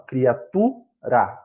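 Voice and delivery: "criatura" slowly, cri-a-TU... ra; teacher sounding out "criatura", stressed TU; stress on penultimate syllable. Shown as a demonstration. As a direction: hold the "tu" in "criatura", then detach "ra". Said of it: "é a penúltima sílaba da palavra".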